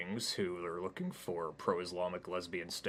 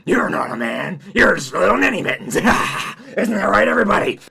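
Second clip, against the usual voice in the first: mocking demonic spider; 12 semitones higher, 22 dB louder than usual. Spider mocks Hayden to crowd.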